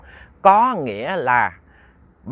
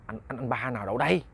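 very soft, hesitant questioning; hushed bewilderment; 3 semitones lower, 10 dB quieter than usual. Acted. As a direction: stammer it quietly, unsure and uneasy. Wife doubts anyone was there. (hỏi khẽ, ngập ngừng)

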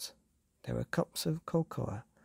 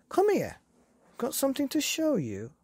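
put-on high old woman's voice calling over; calling grandmother; 13 semitones higher, 4 dB louder than usual. A narrator's reading. Grandmother calls Billy over to show him something. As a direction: beckon the boy over, lightly voiced granny. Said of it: "called his grandmother".

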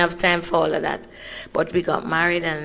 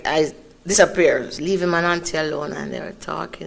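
normal talking voice

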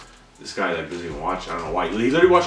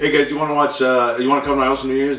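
higher, louder pretend invite; eager mock invitation; 6 semitones higher, 6 dB louder than usual. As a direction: pitch a party to friends, playing it up. Acting out a party invitation.

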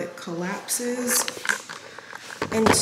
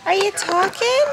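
high, loud cooing; baby talk; 12 semitones higher, 8 dB louder than usual. Acted. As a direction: coo loudly at the baby. Mom cooing at newborn.

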